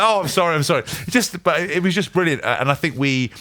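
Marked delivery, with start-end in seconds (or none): 0.0-0.8 absentminded apology. Retold or imitated. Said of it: "And he'd be like".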